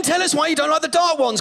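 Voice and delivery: usual speaking voice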